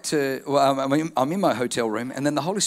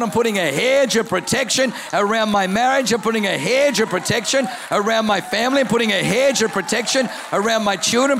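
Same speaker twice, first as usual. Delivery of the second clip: preaching at full force; 5 semitones higher than usual. Acted. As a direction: preach it out, building to a shout. Preaching declaration of protection.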